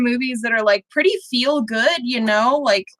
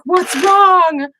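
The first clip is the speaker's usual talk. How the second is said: high, louder worried question, imitating mom; concerned mom; 8 semitones higher, 5 dB louder than usual. Mom walking in on her crying kid, imitated concern. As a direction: retell mom's alarmed question, pitched up.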